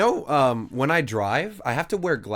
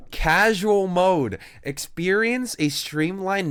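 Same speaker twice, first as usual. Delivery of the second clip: mock announcer; 4 semitones higher, slower than usual. Streamer reading the game menu, slow mocking announcer voice. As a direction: sneer the words out slowly.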